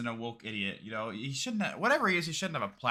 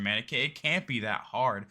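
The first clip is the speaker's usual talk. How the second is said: normal talking voice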